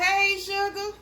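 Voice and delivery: high bright "Hey, Sugar"; cheerful demo hello; sample greeting. Shown as a demonstration. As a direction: show them how to greet you. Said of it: "go on over there and say".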